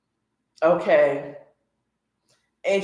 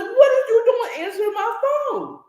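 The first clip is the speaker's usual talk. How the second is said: mock rant; 14 semitones higher than usual. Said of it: "he goes off on her".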